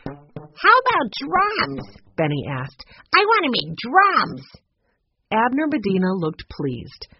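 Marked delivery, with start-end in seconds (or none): 0.0-2.0 put-on high, slow, drawn-out boy's question; little boy hoping for drums; eager boy. Benny eager at workshop. 3.1-4.5 put-on higher, louder excited kid; boy who knows what he wants; eager boy. Benny eager at workshop.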